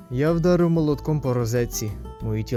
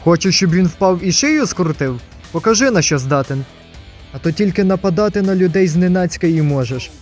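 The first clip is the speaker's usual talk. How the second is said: forceful, raised-voice challenge; loud goading; 3 semitones higher, 5 dB louder than usual. Acted. Goading a rival to prove himself, loud challenge. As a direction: push the rival hard to act.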